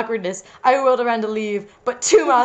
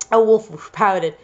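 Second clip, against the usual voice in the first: ordinary delivery, nothing marked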